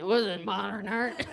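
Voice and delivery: put-on slurring, laughing drunk; drunk slur. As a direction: slur it like you're tipsy. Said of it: "I suddenly got drunk while saying".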